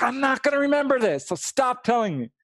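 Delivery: high, loud exasperated snap; fed up, make him stop; mock annoyed brother pushing back; mad brother. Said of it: "he really got really mad at me".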